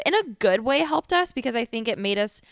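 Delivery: ordinary delivery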